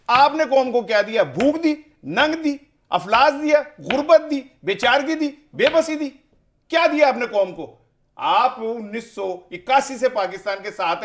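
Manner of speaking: high, loud indignant accusation; angry. Real, not acted. Guest accusing leader of giving only hunger and poverty, angry. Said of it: "ابھی ذرا غصہ اپنا side پہ رکھیں".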